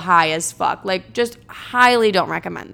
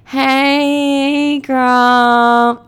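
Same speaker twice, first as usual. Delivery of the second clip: long, high, droning hello; pitched-up, drawn-out dopey drone; stoned awkward greeting; 5 semitones higher, 4 dB louder than usual. Retold or imitated. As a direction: imitate a dazed stoner stretching out the word. Repeats her stoned, awkward reply.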